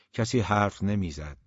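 plain reading voice

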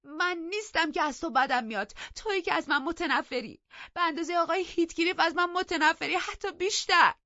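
high, loud crying accusation; wailing, upset reproach that builds; tearful outburst. A narrator's reading. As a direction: burst into tears and protest at a high pitch. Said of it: "گریه سر داد و گفت".

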